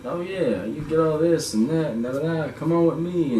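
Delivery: high, coaxing mock temptation; devil's sweet-talk. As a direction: play a tempter luring someone in. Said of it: "how the devil can trick you".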